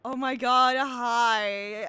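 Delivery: loud, thrilled greeting; excited squeal. Real, not acted. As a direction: gush with delight. Excited at new character.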